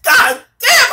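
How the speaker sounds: very high-pitched mock exasperation; exasperated outburst. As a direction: burst out in a squeal of exasperation. Acts exasperation at own laughter.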